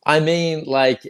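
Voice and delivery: ordinary talk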